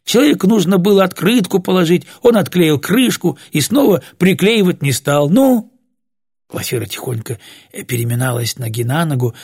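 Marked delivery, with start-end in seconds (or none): 0.0-5.7 raised, louder exasperated explaining; indignant. A narrator's reading. Вася explains the unglued lid to the frightened others, indignant. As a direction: argue it out, pitched up. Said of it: "возмутился Вася".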